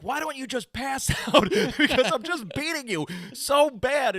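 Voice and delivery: high, loud jeering bully; mocking bully. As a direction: sneer at a beaten opponent. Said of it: "they're like".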